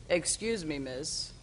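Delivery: put-on low, soft-spoken elderly man; older gentleman impression. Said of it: "That's how he talked. I'm doing my impression".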